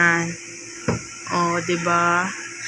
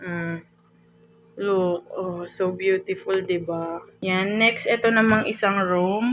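quiet at night; 3 semitones lower, 5 dB quieter than usual. Real, not acted. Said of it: "Kasi baka magising si Baby Earl".

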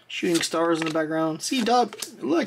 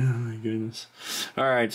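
ordinary delivery

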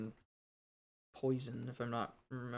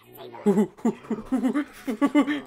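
high, loud frantic burst of laughter; manic laugh; 11 semitones higher, 13 dB louder than usual. Real, not acted. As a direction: crack up in disbelief at the game. Manic laugh after outburst.

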